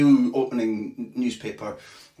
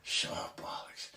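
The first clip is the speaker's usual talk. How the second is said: whispered, much softer muttering; hushed cursing so others won't hear; the chief whispering curses under his breath; mock whisper; 7 semitones lower, 14 dB quieter than usual. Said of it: "he's going".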